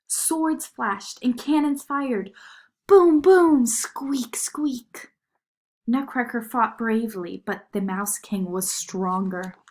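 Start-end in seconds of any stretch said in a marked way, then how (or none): 2.9-3.7 loud booming blasts; cannon booms. A narrator's reading. Narrator voicing the cannons in battle, loud booms. 3.7-5.1 mouse squeaks. A narrator's reading. Mice in battle.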